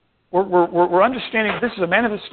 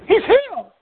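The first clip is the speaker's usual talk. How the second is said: high-pitched, louder exclamation; excited echo; 12 semitones higher, 3 dB louder than usual. Acted. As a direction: exclaim the news in a much higher voice. Quotes the man echoing the news.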